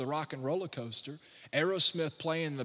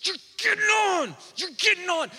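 much higher mock shout; mom shouting; 14 semitones higher, 5 dB louder than usual. Mom dragging her son onto the ride, mock shout. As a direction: yank the words out like a furious mom. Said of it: "pulling this kid".

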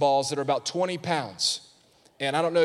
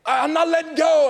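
put-on stubborn kid; 13 semitones higher, 8 dB louder than usual. Boys refusing to drop ball.

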